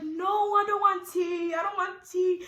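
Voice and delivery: put-on high, loud childlike protest; acted-out tantrum of a woman in labor; whining refusal. Acts out refusing tea in labor.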